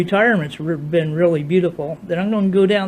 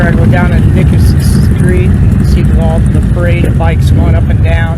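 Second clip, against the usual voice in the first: windy outdoor phone recording; 13 dB louder than usual. Real, not acted. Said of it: "outdoors at a loud bike rally".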